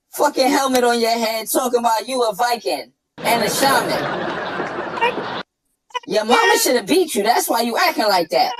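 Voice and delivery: ordinary talk, nothing marked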